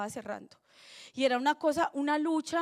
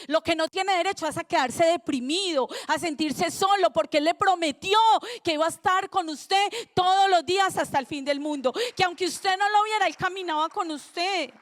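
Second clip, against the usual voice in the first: preaching at full force; 3 semitones higher, 5 dB louder than usual. Acted. Pastor proclaiming God's promise to the church, loud fervent preaching. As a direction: proclaim it to the whole congregation.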